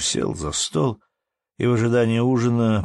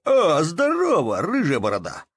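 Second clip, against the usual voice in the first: exclaimed greeting; 9 semitones higher, 3 dB louder than usual. A narrator's reading. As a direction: draw out a high-pitched hello of recognition. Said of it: "воскликнул он".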